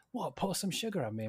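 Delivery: high, surprised checking question; retold American fan's realisation; imitated recognition. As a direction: ask in mock excited disbelief. Imitates American recognising Def Leppard song.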